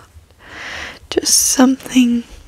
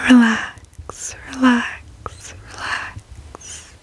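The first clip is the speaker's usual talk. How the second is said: soothing relax; much slower than usual. Real, not acted. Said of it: "soothing repeated relax".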